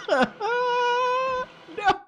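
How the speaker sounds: high, loud cry of no; terrified cry. Real, not acted. Gamer caught by the ghost, a high terrified cry. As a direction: wail as you get caught.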